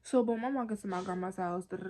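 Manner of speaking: normal talking voice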